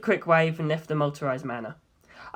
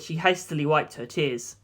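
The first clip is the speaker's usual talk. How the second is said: plain reading voice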